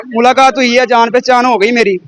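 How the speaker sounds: ordinary talk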